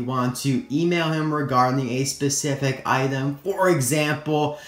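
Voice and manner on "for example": usual speaking voice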